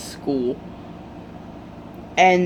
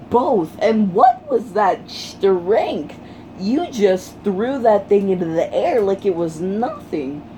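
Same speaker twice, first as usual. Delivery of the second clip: amazed gushing; 3 semitones higher than usual. Retold or imitated. Uraraka marvelling at Deku's strength, amazed gushing. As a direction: stretch the question out in disbelief.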